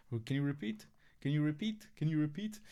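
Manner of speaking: repeatedly asking coworker. Retold or imitated. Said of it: "this guy at work always asking me".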